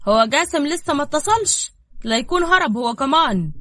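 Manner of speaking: loud, high-pitched sarcasm; mocking. A narrator's reading. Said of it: "واتكلم بسخرية وهو بيضحك".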